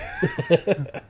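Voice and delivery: giggles in a high squeal; high squeal of laughter. Real, not acted. Cracking up at the Denny's joke, squealing laugh.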